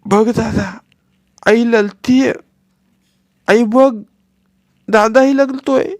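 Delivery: put-on high, lisping little boy tugging at his brother; begging childish whine; little boy coaxing his crying brother; pleading small child.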